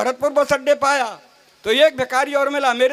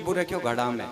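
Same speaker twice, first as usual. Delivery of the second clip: low, softer sulky snap from the wife; angry wife; 12 semitones lower, 6 dB quieter than usual. Acted. Angry wife answering her husband's call for water, low grumble. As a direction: grumble it like an angry wife who won't talk. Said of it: "घरवाली गुस्से में थी".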